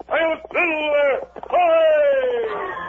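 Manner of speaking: a ringing call to the horse, high and loud; slow, stretched battle cry, then a sharp shout; rallying cry. Acted. Rider spurring his horse Silver on, ringing shout.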